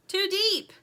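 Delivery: high, loud short shout; shouted warning. A narrator's reading. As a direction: shout back a quick warning. Dirt shouts answer in storm.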